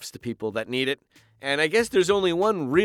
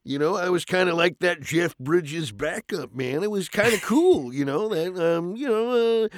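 Jeff Bridges voice; 5 semitones higher, 3 dB louder than usual. Shown as a demonstration. Still in Bridges dude voice.

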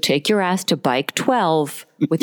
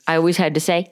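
ordinary delivery

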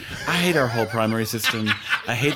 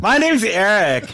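high, loud, slow dopey voice; laughing dim-witted rich kid voice; Eric Trump impression; 8 semitones higher, 6 dB louder, slower than usual. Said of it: "that is my new favorite impression of yours".

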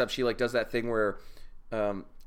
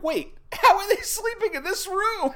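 very high, incredulous squeal; baffled outcry. Acted. Viewer reacting to the absurdly bright room, high-pitched disbelief.

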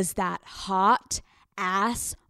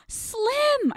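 very high-pitched squealed name; feigned surprise. Acted. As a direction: call out in sugary, put-on astonishment. Feigned delight at seeing him.

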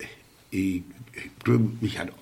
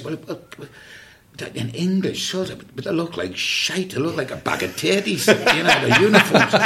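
higher, teasing aunts' voices; mocking aunts; 7 semitones higher than usual. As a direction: take the mickey out of the soldier like gossiping sisters. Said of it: "all her sisters was".

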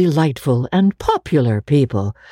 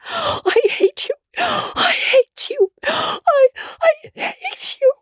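choked crying; slower than usual. A narrator's reading. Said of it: "she cried in a choked voice".

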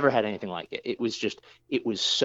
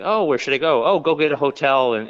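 louder, higher quoted question and answer; retold flustered exchange; 5 semitones higher, 6 dB louder than usual. Stranded intern retelling where he asked to stay, flustered and raised. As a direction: act out a hurried back-and-forth, raised voice.

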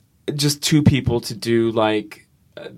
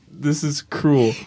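usual speaking voice

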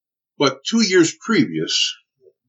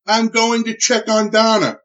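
raised, higher, slowed urgent call; retold yell; 7 semitones higher, slower than usual. Husband to wife's friend, a raised urgent call. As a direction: call out to her in a hurry. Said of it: "I yelled to her".

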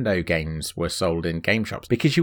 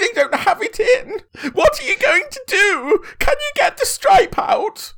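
very high, wailing, laughing sob; mock crying child; 3 dB louder than usual. Co-host acting the boy denied his game, hammed-up sobbing. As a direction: whine like a spoilt boy in tears.

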